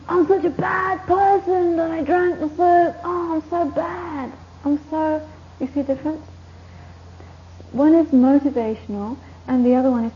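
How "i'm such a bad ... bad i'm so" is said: slow, higher self-pitying wail; a hammed-up guilty moan, slowed and higher; mock self-pity. Shown as a demonstration. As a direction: ham up someone wallowing in guilt. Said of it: "But you don't think".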